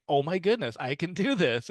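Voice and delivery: light retold joy of a learner who succeeds; excited delight. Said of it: "they're like".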